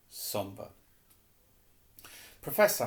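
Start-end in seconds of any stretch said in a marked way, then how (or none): 2.4-2.9 higher, louder startled cry; gasped. A narrator's reading. Harry gasps to his professor as the bird burns, gasped. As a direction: gasp it out in alarm. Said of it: "Harry gasped".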